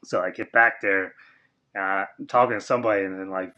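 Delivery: ordinary talk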